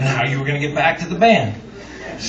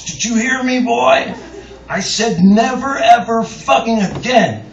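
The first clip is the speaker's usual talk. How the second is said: higher, louder furious scolding; angry yell; 7 semitones higher, 4 dB louder than usual. Retold or imitated. Jerry Jeff yelling at the narrator on the floor, angry. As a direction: bark it down at the boy. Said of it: "and he says".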